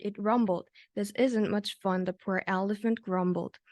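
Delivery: plain reading voice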